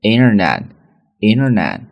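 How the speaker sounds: internet said as inner-net, t dropped; flap-t internet; American flapped internet. Shown as a demonstration. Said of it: "这么去读的".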